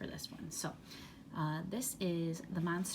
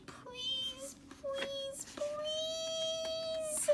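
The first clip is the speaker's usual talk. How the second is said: each please climbing higher and softer into a cry; begging; 4 dB quieter, much slower than usual. Acted. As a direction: plead in rising panic. Grover begs reader not turn.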